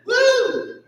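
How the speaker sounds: high, whooping mock cheer. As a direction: whoop it up like the crowd. Quoting people cheering.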